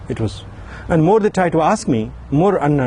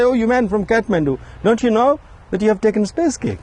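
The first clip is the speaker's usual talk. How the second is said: lightly voiced, higher, amused hosts; teasing hosts; 6 semitones higher, faster than usual. Hosts teasing the poet from Kathmandu, mildly mocking. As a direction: tease him like a naive foreigner. Friends teasing the stoned guest.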